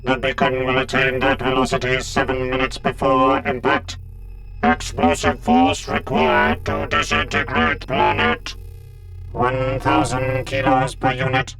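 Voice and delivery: buzzing synthetic drone; robotic Cyberman. A narrator's reading. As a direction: recite data like a machine, evenly spaced. Cybermen exchange attack figures.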